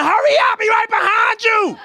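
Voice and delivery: very high, frantic warning yell; urgent yelling. Retold or imitated. Crowd on the dock to the swimmer, urgent yelling. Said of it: "they yelling".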